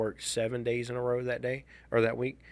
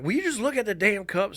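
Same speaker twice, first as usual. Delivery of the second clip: exasperated; 10 semitones higher, 4 dB louder than usual. Retold or imitated. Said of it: "she's like".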